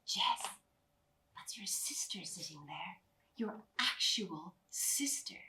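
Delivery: slow, hushed whisper in the ear; soft whisper. Acted. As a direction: whisper it slowly, like an inner voice. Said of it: "softer than the others, whispered in my ear".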